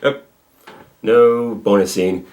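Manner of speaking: ordinary talk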